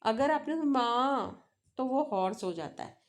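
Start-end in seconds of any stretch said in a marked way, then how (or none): 0.6-1.5 "माँ" drawn out, dipping down and then back up; long scooped "माँ" with a fall then rise; falling-rising tone. Shown as a demonstration. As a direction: let "माँ" sag and climb again, Mandarin third tone. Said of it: "falling rising tone".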